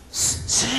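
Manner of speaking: 스 breathed, no vowel sound; voiceless s. Shown as a demonstration. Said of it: "크게 해봐".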